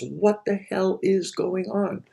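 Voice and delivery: drawn-out mock outrage at the universe; exasperated outcry. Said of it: "the overarching tone of all of that is".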